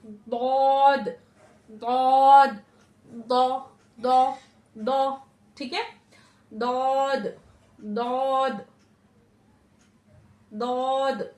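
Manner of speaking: दौद slow and loud, tongue's side on upper molars; teacher practising Daad repeatedly; Daad demo. Shown as a demonstration. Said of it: "touch करके ये हमारी ऊपर की तरफ जाएगी".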